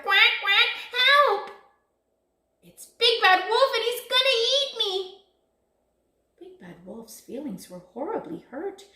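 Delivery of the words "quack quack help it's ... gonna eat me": high, loud duckling panic; frightened cry. A narrator's reading. Number five fleeing Big Bad Wolf, panicked cry. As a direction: squeal out for help. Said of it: "cried number five".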